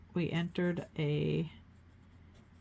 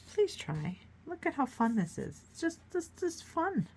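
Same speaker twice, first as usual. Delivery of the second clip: coaxing; 11 semitones higher than usual. Pretend. Coaxing viewers to try.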